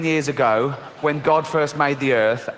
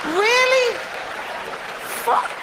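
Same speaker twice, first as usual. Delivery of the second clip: incredulous rant; 12 semitones higher than usual. Real, not acted. Ranting at God's overreaction.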